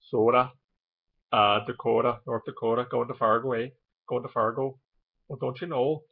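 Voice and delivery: long rounded O's, Minnesota style; Minnesota accent. Shown as a demonstration. Vlogger showing Dakota talk with harsh O's, Minnesota accent. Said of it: "they kinda talk like that".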